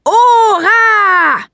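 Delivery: loud, high, far-carrying shout; shouting grandmother. Acted. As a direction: call the name across the yard. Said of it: "hallte es prompt über den Hof".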